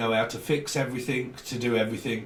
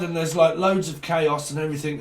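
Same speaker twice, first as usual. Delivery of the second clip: usual speaking voice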